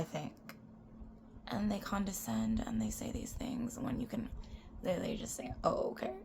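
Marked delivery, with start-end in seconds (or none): 1.5-6.1 soft unvoiced whisper. Real, not acted. Complaining to viewers about condescending people, whispered. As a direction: hush it, no voice at all.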